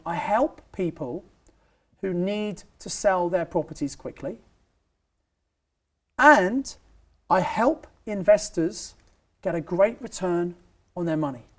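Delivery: slow and spaced, word by word, for note-taking; slow dictation. Shown as a demonstration. Coach dictating a pitch for listeners to write down, slowly. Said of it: "so write this down".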